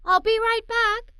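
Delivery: high, loud called-out line; shout. Acted. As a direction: call it across the yard. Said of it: "Marco's mom shouted".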